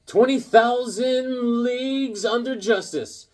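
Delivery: high, slow, grand title announcement; dramatic title reading. Acted. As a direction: proclaim the title with mock grandeur. Reads story title dramatically.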